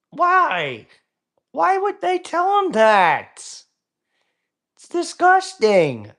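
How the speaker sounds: high, slow, hammed-up shock; mock horror. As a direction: shriek it like a scandalized lady. Mock horrified reaction.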